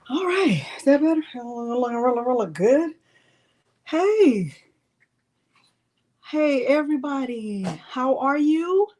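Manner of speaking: ordinary delivery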